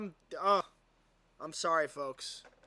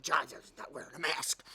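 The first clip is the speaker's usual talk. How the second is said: whispered tattletale gossip; mock whisper; 2 semitones lower than usual. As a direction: hiss it like a snitch.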